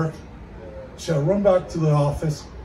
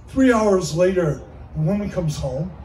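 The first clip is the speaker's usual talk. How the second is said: ordinary talk, nothing marked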